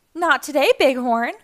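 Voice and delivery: loud, high defiant call. Acted. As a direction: call it out to the truck as you dodge. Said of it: "called A J".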